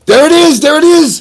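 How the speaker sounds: high, loud triumphant shout; excited shout. Retold or imitated. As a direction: yell it like you just caught it. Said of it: "We both said it".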